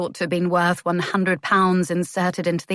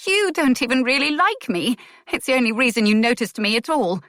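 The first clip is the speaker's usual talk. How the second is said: higher, louder, rushed hurt; shaking accusation; 6 semitones higher, 4 dB louder than usual. A narrator's reading. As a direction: throw it at him before you lose nerve. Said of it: "her voice was shaking".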